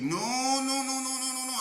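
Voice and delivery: high squeaky chant of no, no, no; insistent old man. Retold or imitated. Old man refusing to wait for his money, high squeaky insisting. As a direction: keep it high and pushy.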